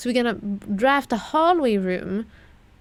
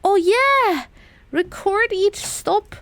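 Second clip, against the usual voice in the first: loud and much higher, a burst of realization; excited breakthrough; 8 semitones higher, 6 dB louder, slower than usual. Real, not acted. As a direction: shout it like you just got it. Suddenly cracking the puzzle clue.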